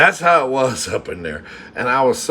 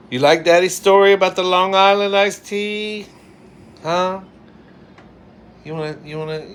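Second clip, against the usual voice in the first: talking to a pet; 10 semitones higher, 5 dB louder than usual. Real, not acted. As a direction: coo at the little listener beside you. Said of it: "I've got an audience here".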